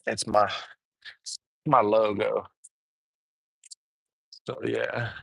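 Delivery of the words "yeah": normal talking voice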